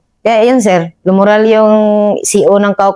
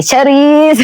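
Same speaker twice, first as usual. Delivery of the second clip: calling out; 7 semitones higher than usual. Real, not acted. Calling out in shoutout.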